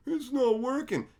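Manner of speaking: high-pitched mock complaint; whiny, put-on quote; mocking whine. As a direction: imitate friends whining in defeat. Imitates friends giving up.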